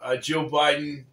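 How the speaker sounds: slow, hammed-up Yugoslavian accent on the names; jokey Yugoslavian accent reading a name. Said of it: "Yugoslavian accent".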